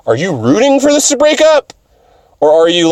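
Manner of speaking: high, squeaky, disbelieving question; mock question to cat. The speaker asking the cat about the breakup, in a high mock voice.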